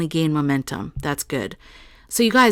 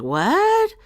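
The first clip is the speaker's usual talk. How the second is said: high, rising incredulous cry; astonished mock outburst; blown-away disbelief; 3 semitones higher than usual. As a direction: re-enact being stunned, pitch swooping way up. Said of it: "I was blown away by that".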